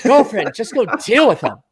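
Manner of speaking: high camp fret, laughing; campy pharaoh shrugging off invaders with a giggle; lightly camp pharaoh, laughing; flamboyant pharaoh. Retold or imitated. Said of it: "a very flamboyant Pharaoh".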